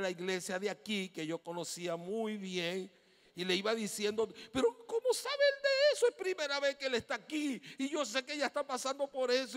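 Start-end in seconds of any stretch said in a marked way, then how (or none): 4.5-9.6 high, loud astonished voice; high, loud disbelief of a church member; amazed exclamation. Retold or imitated. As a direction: gasp it out like someone stunned.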